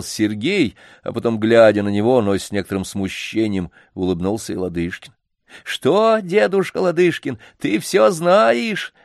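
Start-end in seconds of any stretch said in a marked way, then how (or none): 5.6-8.9 put-on much higher, slow sly teasing boy. A narrator's reading. Sly boy teases the old man, high and playful. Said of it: "поддразнил его лукавый Сергей".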